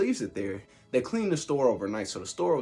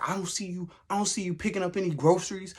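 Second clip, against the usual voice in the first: higher, sarcastic ranting; mock tirade; 8 semitones higher than usual. Date ranting at him about the gallon, higher sarcastic tirade. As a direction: pile on the sarcasm. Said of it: "she was just going in on me".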